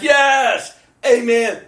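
high, loud shouted affirmation; enthusiastic congregation. Acted. Church answering the preacher, enthusiastic shout. Voicing the church's eager response.

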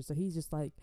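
usual speaking voice

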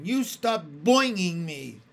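put-on girl shrieking at a teasing classmate. A narrator's reading. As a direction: order her to stop, shrill with outrage. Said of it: "shrieked Susan".

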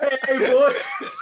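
put-on high, loud, laughing drunk hail; laughing mock-drunk cheer; drunk greeting. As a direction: ham up a hammered mate's greeting. Drunk friend greeting retold.

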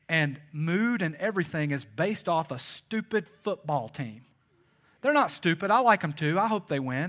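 loud, riled-up preaching; worked-up preaching. Real, not acted. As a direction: press the point to the congregation with heat. Preacher riled up about fans.

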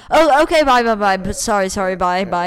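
hurried goodbye. Retold or imitated.

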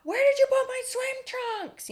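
put-on very high kid's call from upstairs; mock holler in a high child voice; imitated kid calling. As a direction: call down to mom without real volume. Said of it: "the kids are like".

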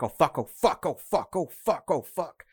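lightly voiced panicked runner; panicked muttering. Fleeing stranger curses to himself, mild panic. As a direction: repeat it breathless and scared, under the breath. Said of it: "under the breath".

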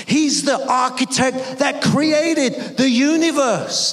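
high-pitched, emphatic sermon climax; stretched key words, ringing preacher's declaration; impassioned preaching. Real, not acted. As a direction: drive each phrase home to the congregation. Preacher at full force.

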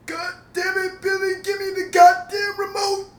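high, loud shouting cranky grandpa. Retold or imitated. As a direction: holler like a grumpy old grandpa. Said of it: "I used my grandpa voice".

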